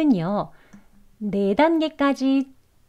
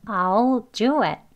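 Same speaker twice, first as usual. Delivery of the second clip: "I'll do it" drawn out, each word separate; slow teaching model of "I'll do it"; much slower than usual. Shown as a demonstration. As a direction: say "I'll do it" unhurried, I'LL as a long "ah-ol". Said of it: "천천히".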